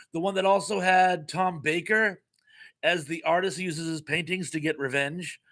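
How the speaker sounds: ordinary talk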